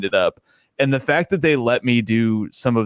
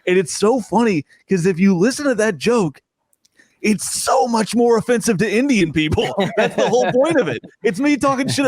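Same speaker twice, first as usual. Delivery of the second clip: amused and excited; 8 semitones higher than usual. Real, not acted. Comic telling a podcast about online critics, gleefully high. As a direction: pitch climbing high with glee.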